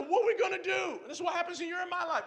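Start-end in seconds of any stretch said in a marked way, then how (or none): 0.0-1.0 panicked cry. Acted. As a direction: cry out for help. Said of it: "He crying out to Elisha".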